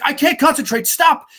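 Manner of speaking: high and loud, rising to "Stop"; frustrated outburst. Retold or imitated. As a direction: snap in exasperation. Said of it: "He's like".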